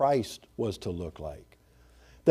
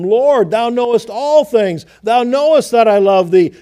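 grieved loud plea; 7 semitones higher, 9 dB louder than usual. Retold or imitated. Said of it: "Peter was grieved".

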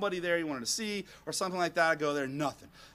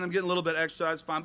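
normal talking voice

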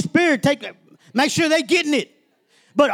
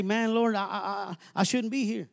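weeping prayer; 7 semitones lower, 10 dB quieter than usual. Retold or imitated. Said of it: "weep for the Lord and say".

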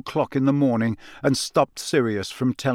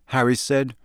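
plain reading voice

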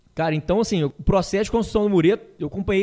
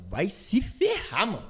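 slow fed-up retort; 9 dB quieter, slower than usual. Retold or imitated. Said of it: "Puto, puto".

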